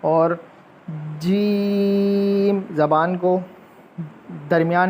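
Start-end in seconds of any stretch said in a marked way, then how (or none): 1.2-2.6 जीम very slow, teeth nearly closed; jeem demo. Shown as a demonstration. Teacher demonstrating जीम with the teeth nearly closed. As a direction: hold जीम long. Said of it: "थोड़ा सा खोल के बंद करते हुए".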